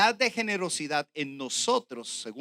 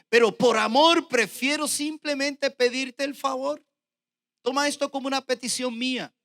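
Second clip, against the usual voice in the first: raised, forceful verse reading; preached scripture reading; 7 semitones higher, 6 dB louder than usual. Real, not acted. Preacher reading Paul's plea to Philemon, proclaimed. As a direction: read it out high and measured, like proclaiming. Reads Paul's letter aloud.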